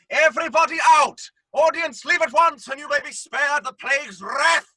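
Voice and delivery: loud proclamation; commanding shout. Acted. Masked man halting the play, shouting. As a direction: stop the show by force. Ordering audience out.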